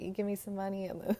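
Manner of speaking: giggling while telling the story; laughing. Real, not acted. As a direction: laugh the line out.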